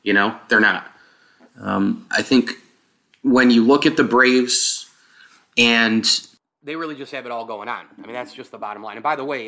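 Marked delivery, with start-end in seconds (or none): 0.0-6.0 hollow, roomy mic sound; like recording in a big empty room; echoey open sound. Real, not acted. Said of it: "if you heard kind of an echoey open thing".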